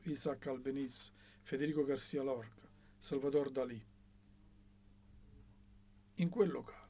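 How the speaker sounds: usual speaking voice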